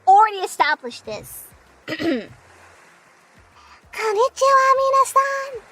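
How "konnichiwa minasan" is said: higher, slow anime voice on minasan; anime greeting. Shown as a demonstration. Streamer retries the anime intro, slow and sweet. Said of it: "I can't do a mummy's voice".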